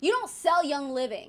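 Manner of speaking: ordinary delivery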